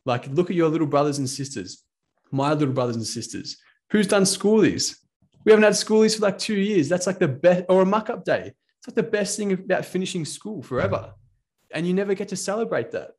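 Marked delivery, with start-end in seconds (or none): 3.9-13.1 excited. Real, not acted. Venting about lost school celebrations.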